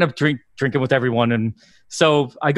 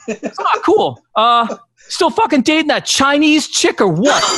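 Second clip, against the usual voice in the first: hammed-up Boston guy, stretched out and pitched up; imitated innocent Bostonian; 12 semitones higher, slower than usual. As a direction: ask it cluelessly, in mock Boston. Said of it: "innocent question from a Bostonian".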